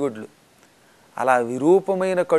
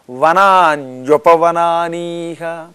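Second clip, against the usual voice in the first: verse recitation; 5 dB louder, much slower than usual. Acted. Quotes verse word.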